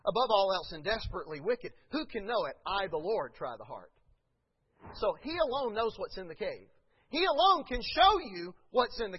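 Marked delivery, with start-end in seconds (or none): none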